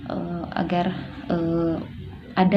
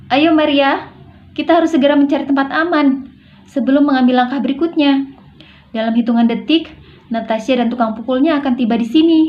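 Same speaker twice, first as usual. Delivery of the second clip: higher, louder call to come along; urging invitation; 6 semitones higher, 4 dB louder than usual. Shown as a demonstration. Urging Maria to find safety before Natasha arrives, insistent. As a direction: urge her to move with you now. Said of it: "intonasi ajakan".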